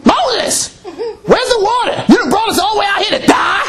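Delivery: high, fast, shouted whining; upset Israelites. Retold or imitated. Preacher voicing thirsty Israelites yelling at Moses, high whine. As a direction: yell the complaint at Moses. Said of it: "They upset".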